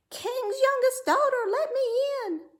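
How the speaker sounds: very high, loud crying call; frog calling. A narrator's reading. Frog at the palace door calling the princess, high loud cry. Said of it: "a voice crying".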